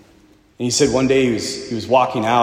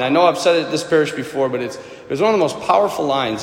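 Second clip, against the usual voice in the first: ordinary talk, nothing marked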